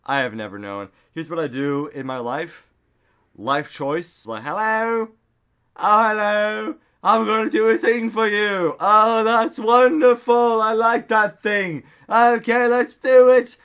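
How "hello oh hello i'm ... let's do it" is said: high, loud, drawn-out gushing; gushing put-on voice. Greeting someone and offering to do a thing, over-the-top gushing. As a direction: gush in a loud high character voice. Acts out a show-off routine.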